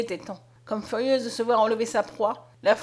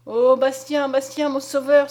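loud pleading call; cling to her last hope; desperate cry; 3 semitones higher, 7 dB louder, slower than usual. A narrator's reading. Mother crying to her rescuer, loud and pleading. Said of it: "s'écria Julienne".

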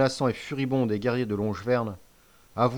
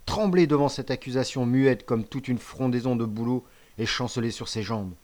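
usual speaking voice

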